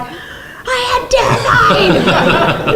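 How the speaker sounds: very high, loud, drawn-out wail; terrified yell. Retold or imitated. Actress answering crew that she looks terrified, hammed-up high yell. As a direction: yell it back slowly, laughing at the memory.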